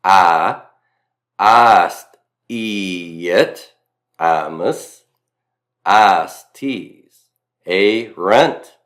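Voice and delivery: Latin endings stretched in a thick Mississippi drawl; one-syllable vowels pulled into two, Southern style; mock Southern drawl. Shown as a demonstration. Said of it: "imagine me from Mississippi with my thick Southern drawl".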